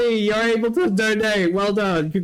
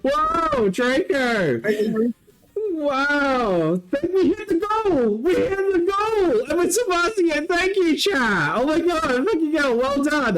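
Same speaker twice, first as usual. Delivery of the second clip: cheering for chat hitting the goal, screaming with joy; excited screaming; 10 semitones higher, slower than usual. Real, not acted. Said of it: "I'm screaming like nobody's business".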